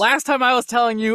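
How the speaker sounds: normal talking voice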